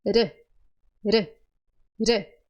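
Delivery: re with the tongue tapping the palate; tapped R. Shown as a demonstration. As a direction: tap the r in re quickly. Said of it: "a sua língua ela vai subir".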